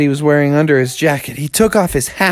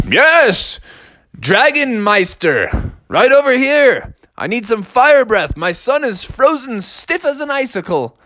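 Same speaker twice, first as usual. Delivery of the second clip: high, theatrical summons; calling a dragon; 7 semitones higher than usual. Acted. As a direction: call up to the sky for help. Father bear summons the dragon.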